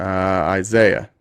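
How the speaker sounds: loud, slow drawn-out call of the name. Real, not acted. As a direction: call the next caller in, stretching his name. Calling next caller by name.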